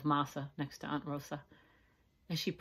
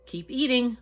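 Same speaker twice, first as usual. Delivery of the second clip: higher, louder scold; 8 semitones higher, 9 dB louder than usual. Acted. Maria pushing tired cousins on, scolding. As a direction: scold the cousins to keep going. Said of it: "Maria scolded".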